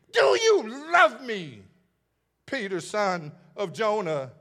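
high, loud, slow plea; Jesus pleading. Acted. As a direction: stretch it out, pleading. Third question, drawn out.

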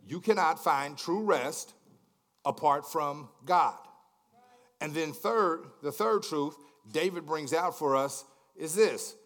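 ordinary talk, nothing marked

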